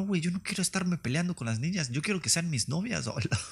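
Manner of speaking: ordinary delivery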